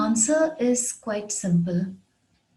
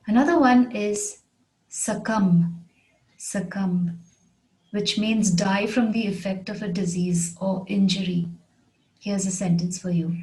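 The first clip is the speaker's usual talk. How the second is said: ordinary delivery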